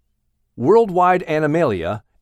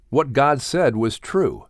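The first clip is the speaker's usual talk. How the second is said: ordinary delivery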